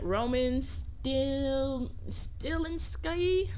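sounding out a name very slowly; soft, drawn-out questioning attempt; unsure pronunciation. Real, not acted. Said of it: "I don't know how the fuck to pronounce that".

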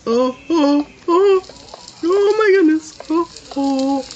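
high panicked squeals. Real, not acted. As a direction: panic while running away. Fleeing a cow.

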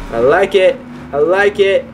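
ordinary delivery

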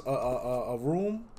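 ordinary talk

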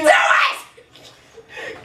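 excited shout. Real, not acted. Egging him on.

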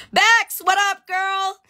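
high, loud happy greeting; excited shout-out. Real, not acted. Greeting a viewer who joined the live, excited high shout-out. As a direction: call out to a friend across the room.

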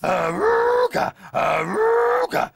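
horn call. Acted. Calling the pups.

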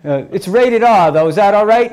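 eager student asking. Retold or imitated. Students asking teacher to show film.

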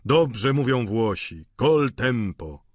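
slow, deliberate put-on voice; głos Vautrina. A narrator's reading. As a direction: draw the words out in an altered voice. Vautrin odpowiada na okrzyk. (powoli, znacząco, zmienionym głosem)

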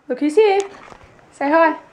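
high, loud and bright, welcoming a little one; excited greeting. Real, not acted. Greeting the little visitor, high and loud. Greeting a small child at the door.

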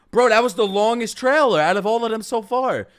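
loud, high, wowed; amazed. Real, not acted. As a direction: gush about it, amazed. Amazed at trailer length.

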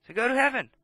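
ordinary delivery

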